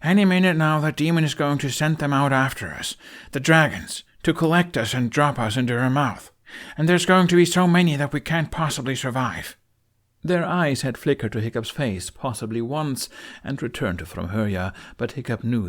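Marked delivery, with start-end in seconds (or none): 0.0-9.5 high, loud, alarmed warning; rally them against the coming danger; urgent, frightened warning. A narrator's reading. Hiccup warns of dragon attack.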